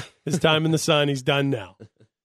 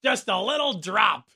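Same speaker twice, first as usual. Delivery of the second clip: mimicked clip; 6 semitones higher, slower than usual. Echoes the played Berman clip.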